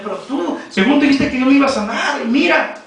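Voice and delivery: high, imitated complaint to a parent; reproachful. Preacher voices daughter reproaching her papá, reproachful. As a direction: throw the promise back at him, acted. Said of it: "ella iba y le reclamaba al papá".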